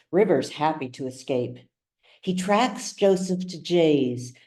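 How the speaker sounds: plain reading voice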